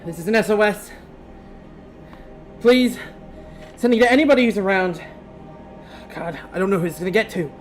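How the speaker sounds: high, loud, frantic plea; panicked distress call. Acted. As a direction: beg for help in panic. GM voices a distress message.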